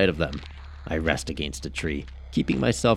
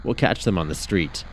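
ordinary delivery, nothing marked

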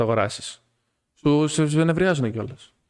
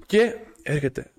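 normal talking voice

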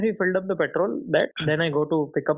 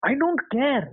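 higher-pitched mock outrage; indignant retort, raised pitch; retold anger; 9 semitones higher than usual. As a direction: act out an angry refusal without shouting. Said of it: "I was so angry".

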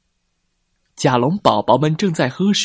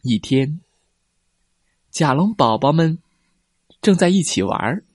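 ordinary delivery, nothing marked

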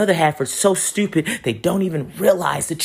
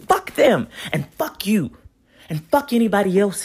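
furious outburst; 5 semitones higher than usual. Real, not acted. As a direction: spit it out in real fury. Angry rant at transphobes.

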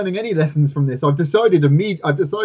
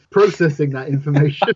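ordinary talk, nothing marked

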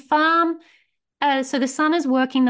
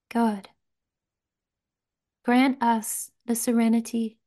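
serenity prayer. Acted. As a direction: pray it gently, letting each line settle.

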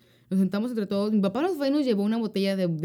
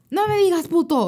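a touch of angry friend, higher and louder; retold angry retort; 10 semitones higher, 8 dB louder than usual. Friend retorts angrily at being insulted. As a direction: snap back offended. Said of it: "estaba enojado".

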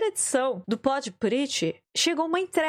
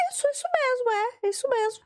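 high, eager confirmation; eager yes; 9 semitones higher than usual. Retold or imitated. Herself confirming the address to the courier, high eager voice. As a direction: chirp it out eagerly. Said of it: "Eu falei".

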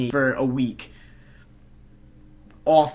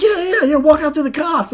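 high excited cheering; pumped-up crowd agreeing; 12 semitones higher, 5 dB louder than usual. Retold or imitated.

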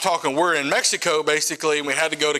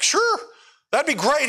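eager; 9 semitones higher than usual. Retold or imitated. Eager reply to the pastor's offer.

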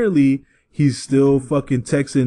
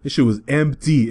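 normal talking voice

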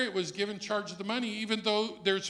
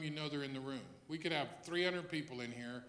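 ordinary talk

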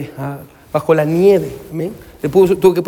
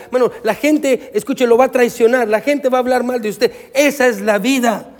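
higher-pitched, driving sermon delivery; impassioned preaching; 7 semitones higher than usual. Real, not acted. Pastor warning parents to build on Jesus, impassioned preaching. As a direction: preach it urgently to the congregation.

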